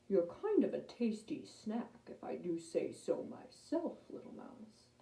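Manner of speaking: soft, sinking to a whisper on "little mouse"; menacing lion. Acted. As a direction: purr a threat at the little mouse.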